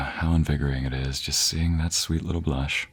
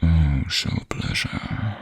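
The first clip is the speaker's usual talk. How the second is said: teasing whisper; much slower than usual. Acted.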